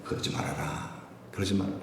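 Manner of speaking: hushed, gentle restraint; the king quietly holding back his general; quiet king's restraint. Retold or imitated. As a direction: whisper it softly to calm him. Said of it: "다윗이 뭐라 그래요".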